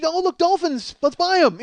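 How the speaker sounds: high-pitched mock-excited shopper; mocking wife. Husband mimicking wife's impulse buys, mock excitement. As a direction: gush mockingly at a silly find.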